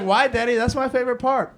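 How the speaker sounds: put-on high little-kid plea; imitated protesting child. Son asks dad about popping part, mock childlike protest. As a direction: play a small boy objecting to dad.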